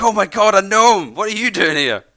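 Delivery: hammed-up shock at spotting a gnome; mock surprise. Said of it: "he's not been like".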